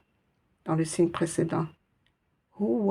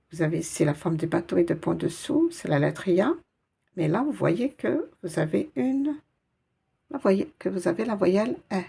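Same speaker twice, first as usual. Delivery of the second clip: usual speaking voice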